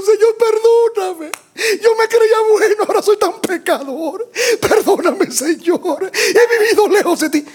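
high, broken, crying plea; tearful repentant prayer. Pretend. New convert praying to the Lord, acted weeping and high. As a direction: weep as a sinner begging forgiveness.

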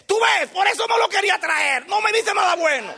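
high, loud furious royal shout; angry shout. Retold or imitated. King Acab raging at his counselor, shouting. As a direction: yell it in a rage. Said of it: "Y se enoja y dice".

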